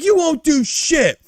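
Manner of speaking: high, loud, drawn-out taunt; taunting dare. Retold or imitated. Pharmacist daring his coworker to walk out, high slow taunt. Pharmacist dares him in quarrel.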